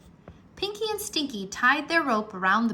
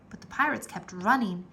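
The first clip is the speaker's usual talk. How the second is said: ordinary delivery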